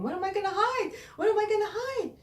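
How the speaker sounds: high, loud frantic whisper-shout; Sarah panicking as the beast comes; panicked. Acted. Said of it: "She panicked".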